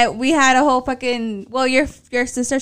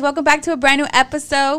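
ordinary talk

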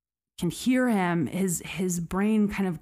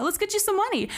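much higher, cheerful reassurance; eager offer; 13 semitones higher, much faster than usual. Retold or imitated. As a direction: humor him into calm. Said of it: "retold quote".

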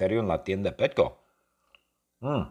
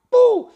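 mock explosion; 13 dB louder than usual. Lightning blast sound effect.

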